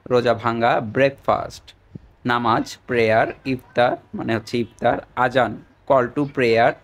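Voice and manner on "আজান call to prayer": each word drawn out slowly for students to copy; slow dictation. Shown as a demonstration. Teacher reading vocabulary to students, slow and deliberate. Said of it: "teacher dictating vocabulary".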